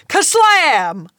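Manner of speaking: loud comic-book sound effect; comic crash word. Retold or imitated. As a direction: bang it out like a comic panel. Sound of mom bursting in.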